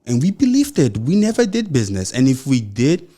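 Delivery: ordinary talk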